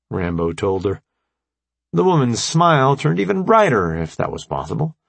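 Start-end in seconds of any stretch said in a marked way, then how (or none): none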